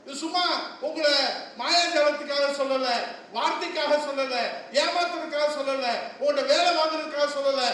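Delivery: shouted, high-pitched stage speech; fiery rally oratory. Acted. Politician roaring to party workers at the rally. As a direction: thunder it to the crowd.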